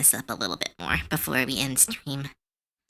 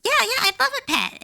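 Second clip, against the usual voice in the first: eager player voice; 3 dB louder than usual. Retold or imitated.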